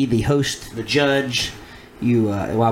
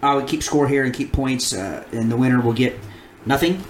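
ordinary talk

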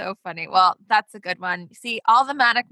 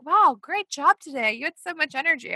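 higher-pitched praise, imitating a trainer; imitated upbeat trainer; 6 semitones higher than usual. Trainer praising a client after a workout, imitated praise. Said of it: "even my trainer was like".

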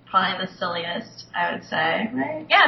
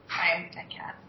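whisper; 4 semitones lower, 9 dB quieter than usual. Real, not acted. Playful whispered hello.

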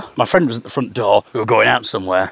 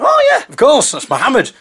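mock recognition; 11 semitones higher than usual.

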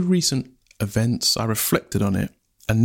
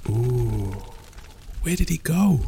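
puzzled crowd thought; 5 dB quieter than usual. Acted. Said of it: "thought the people".

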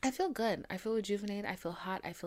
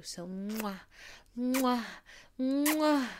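blowing kisses; 4 semitones higher, much slower than usual. Real, not acted. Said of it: "the kisses that I'm sending to you".

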